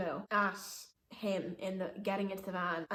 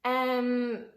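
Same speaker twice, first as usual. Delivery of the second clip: hesitant, drawn out and higher; hesitate, then agree while hinting you don't mean it; unconvincing yes; 4 semitones higher, 5 dB louder than usual. Retold or imitated. Woman secretly signalling driver for help, unconvincing yes. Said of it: "trying to make it seem like".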